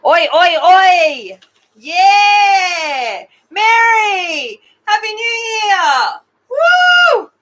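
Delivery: loud, high, drawn-out whooping; celebratory cheer. Real, not acted. Streamer cheers New Year with the chat, celebratory shouting. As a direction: shout it out like a party toast.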